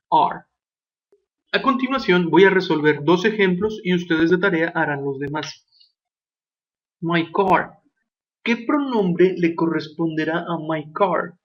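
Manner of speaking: ordinary delivery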